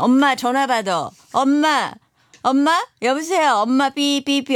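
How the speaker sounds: mock daughter pestering mom by phone; calling for mom. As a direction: call out for mom as if she won't pick up. Acts out daughter phoning mom.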